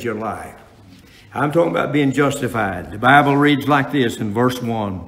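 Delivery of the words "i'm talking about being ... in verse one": loud, ringing sermon delivery; preaching at full force. Acted. Preacher to his congregation, preaching loud and full.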